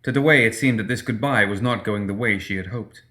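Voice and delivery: ordinary delivery, nothing marked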